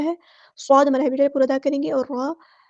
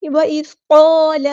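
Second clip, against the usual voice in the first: slow, drawn-out chanted recitation; tajweed chant; Quranic recitation; 8 dB louder, much slower than usual. Shown as a demonstration. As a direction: recite it melodically, stretching each sound. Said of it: "recites Quran verse in tajweed".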